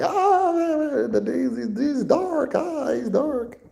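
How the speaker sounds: high, squeaky imitation of the locals, drawn out at the end; mock local voice. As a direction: play the puzzled locals in a high put-on voice. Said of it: "So it was just like".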